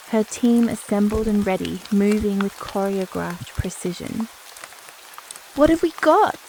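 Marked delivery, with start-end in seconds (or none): 5.6-6.4 raised, higher-pitched call to the arriving crew; loud, high, commanding question. A narrator's reading. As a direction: call it out briskly as the one in command. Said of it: "she called out".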